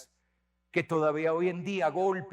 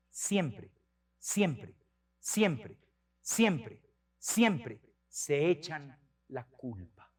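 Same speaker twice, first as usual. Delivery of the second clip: each siempre hammered with a falling tune, then slow; stress on siempre; 5 semitones lower, much slower than usual. Shown as a demonstration. Priest driving home that kids always blame themselves, stressing siempre. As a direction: lean hard on every siempre. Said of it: "repeats word for emphasis".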